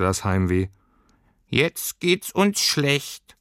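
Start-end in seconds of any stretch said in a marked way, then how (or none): 1.5-3.4 high, very slow doleful creature; squeaky furry creature. A narrator's reading. Creature announces shared misery to girl, squeaky. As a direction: draw it out in a small creature voice.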